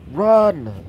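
high, loud drawn-out call; urgent warning shout. Acted. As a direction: shout to a crowd to flee. Deku calls out to civilians.

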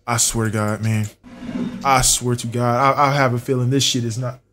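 quiet, low-pitched worry; subdued, uneasy undertone; low, uneasy swearing. Real, not acted. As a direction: say it low and soft, full of doubt. Doubting himself under pressure.

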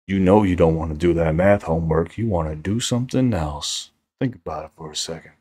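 low, teasing seductive murmur; mock sexy talk. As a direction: tempt them away from homework, hammed-up. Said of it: "That is the worst sexy talk ever".

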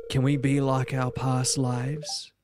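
low, lingering dialogue read; reading love-struck suitor. Acted. Reads game dialogue.

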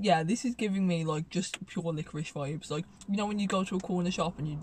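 talking between bites; chewing while talking. Real, not acted. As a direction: mouth partly full of candy. Seen 1: Holds bitten licorice piece throughout, brings it to mouth near +3.7.

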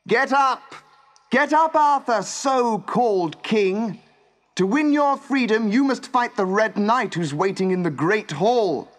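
high, loud commanding shout; woman's imperious shouted summons. A narrator's reading. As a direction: shout orders through a closed door. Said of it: "A woman's voice shouted through the door".

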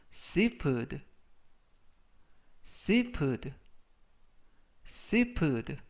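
seafood said as "see-food"; pronunciation model. Shown as a demonstration. Teacher modelling seafood for learners. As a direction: say seafood clearly three times. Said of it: "라고 발음하시면 되겠습니다".

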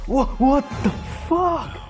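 sudden high startled cry; startled shout. Real, not acted. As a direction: jump at the fright. Startled by a monster.

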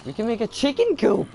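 high, loud burst of delight at the idea; excited eureka. Real, not acted. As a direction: shout it like you just solved everything.

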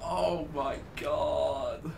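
soft, very slow drawn-out exclamation; hushed, drawn-out disbelief; winded disbelief. Real, not acted. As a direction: let it sigh out quietly after laughing. Recovering from hysterics.

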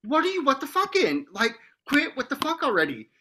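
high, mock-exasperated scolding; hammed-up high-pitched telling-off. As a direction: squeak it out like a fed-up mom teasing. Mom retells snapping at daughter.

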